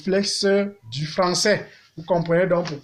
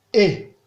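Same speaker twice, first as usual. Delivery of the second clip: Et short, dropping low. Shown as a demonstration. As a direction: drop Et briefly to the bottom. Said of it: "Ton bas". (« Et » au ton bas)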